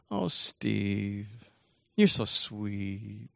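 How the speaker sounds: soft, drawn-out melting sigh; touched, gushing. Retold or imitated. Kristen moved by Steve's birthday tree, tenderly gushing. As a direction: act out being deeply touched, softly.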